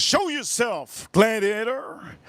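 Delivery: slow, high mock emperor call; hammed-up movie king. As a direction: drag it out like a cheap movie villain. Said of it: "He says".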